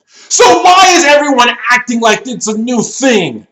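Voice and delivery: high, very loud outburst of disbelief; indignant rant at full volume; exasperated shouting. Real, not acted. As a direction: burst out yelling in frustration. Ranting outburst at the plot hole.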